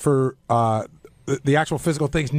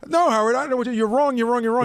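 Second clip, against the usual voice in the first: insistent denial; 6 semitones higher than usual. Retold or imitated. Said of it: "retelling Sal's insistent denial".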